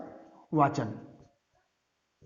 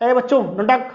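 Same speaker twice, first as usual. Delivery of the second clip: jerky command; 3 semitones higher than usual. Retold or imitated. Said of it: "don't talk को इतना झटके में बोलते थे".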